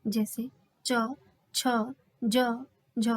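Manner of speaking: च, छ, ज, झ धीरे धीरे, रगड़ के साथ बोलें; हर वर्ण को खींचकर दिखाएँ; स्पर्श संघर्षी वर्ण. Shown as a demonstration. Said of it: "धीरे धीरे खुलते हैं". (कक्षा में उच्चारण दिखाते हुए धीमे वर्ण)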